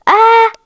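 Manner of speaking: high, loud cry of fright; sharp "aah" at a jump scare; startled scream. Real, not acted. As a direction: yelp in sudden alarm. Startled as Granny appears.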